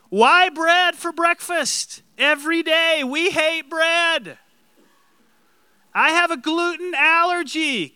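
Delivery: high, loud, whining complaint; whiny grumbling Israelite. Acted. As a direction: whine at God like a fed-up wanderer. Mocking grumbling Israelites at God.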